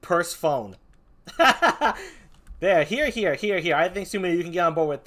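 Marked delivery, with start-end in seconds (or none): 0.0-0.8 two English words, purse and phone; Persephone as purse-phone. Shown as a demonstration. Reading out a guest's complaint, Persephone wrongly said purse-phone. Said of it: "so long as it's not pronounced".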